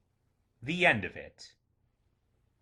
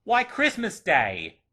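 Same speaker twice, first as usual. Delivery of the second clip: put-on higher, louder, drawn-out boyish answer; 5 semitones higher, 5 dB louder, slower than usual. A narrator's reading. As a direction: answer as an amazed lad. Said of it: "replied the boy".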